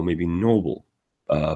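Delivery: ordinary talk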